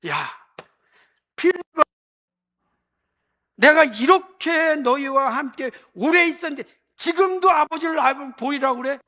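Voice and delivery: irritated Jesus. Retold or imitated. Jesus scolding Philip, exasperated and loud. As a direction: snap at him, fed up. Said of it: "좀 짜증나셨다니까".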